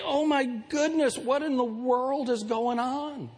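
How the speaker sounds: high, slow, drawn-out exasperation; frustrated dismay. Acted. Preacher voicing people looking around at the world, dismayed. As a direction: lament it in a high, stretched voice. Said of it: "you get frustrated and you say".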